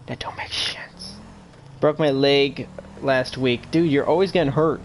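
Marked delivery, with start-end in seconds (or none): 0.1-1.2 slow, breathy whispered aside. Real, not acted. Puzzled at the chat, he whispers slowly.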